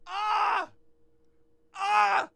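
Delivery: high, loud scream of joy; delighted scream. Real, not acted. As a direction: let out a thrilled yell. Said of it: "Sorry. I'm sorry".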